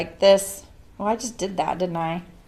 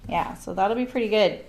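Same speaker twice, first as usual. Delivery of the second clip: ordinary talk, nothing marked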